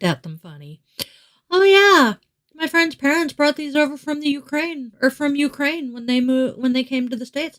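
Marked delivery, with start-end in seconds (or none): 1.5-7.6 higher, bright-voiced quote of her child, lightly voiced; imitating her kid. Mother retelling what her middle child said, higher imitation. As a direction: play the kid, pitched up and casual. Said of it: "my kiddo made reference to".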